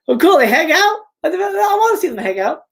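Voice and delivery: high-pitched fan delight; excited. Real, not acted. Reader reacts to Sydney and Randy hanging out, real excitement. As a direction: pitch way up, thrilled.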